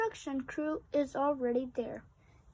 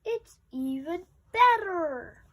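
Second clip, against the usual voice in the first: louder happy exclamation; excited shout; 4 semitones higher, 8 dB louder than usual. Acted. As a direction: Pete cheers about the new playground. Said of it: "Pete shouts".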